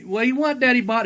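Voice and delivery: high-pitched imitation of a grandmother; grandmother's reply. Grandmother answers her bellowing husband, high imitated voice.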